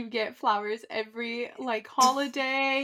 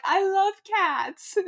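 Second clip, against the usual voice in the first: high, drawn-out mock gush; gushing retold quote; 9 semitones higher, 5 dB louder, much slower than usual. Woman quoting someone's love of cats, mock gushing. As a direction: draw it out high, quoting an enthusiast.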